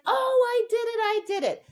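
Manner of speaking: high proud dog voice; voicing the dog. Trainer voicing her dog after success, high and proud. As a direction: play the dog thrilled with itself. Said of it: "my dog's like".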